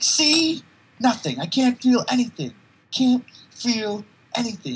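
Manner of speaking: high, loud giddy yelling; manic shout. Retold or imitated. Patient boasting he feels nothing, high loud yell. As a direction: crow it while banging your head. Patient smashing head, retold.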